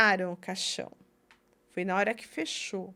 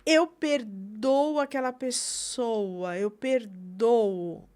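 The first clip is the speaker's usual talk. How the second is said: very slow, drawn-out words of forgiveness; deliberate declaration; much slower than usual. Acted. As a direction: declare it slowly, stretching each phrase. Models declaring forgiveness aloud. (declaração de perdão, lenta e pausada)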